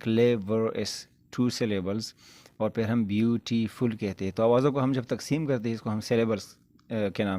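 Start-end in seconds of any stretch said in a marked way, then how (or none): 0.0-2.1 drawn out slowly, syllable by syllable; slow syllable demo. Shown as a demonstration. Teacher slowly showing students the syllables, drawn out. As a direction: spell out the beats of clever for the class. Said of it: "go is one syllable".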